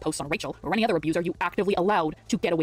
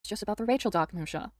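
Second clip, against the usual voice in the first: usual speaking voice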